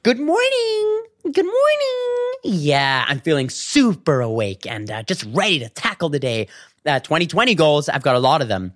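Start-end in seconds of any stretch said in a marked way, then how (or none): 0.0-6.5 very high, sing-song forced cheer; fake chipper morning voice. Putting on a cheery face for viewers, very high and sing-song. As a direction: pretend to be bright and awake for the camera.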